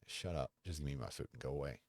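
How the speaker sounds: usual speaking voice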